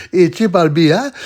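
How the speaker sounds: hammed-up West African accent; Ghanaian friend. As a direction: greet Triple B in a playful Ghanaian accent. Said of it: "he goes".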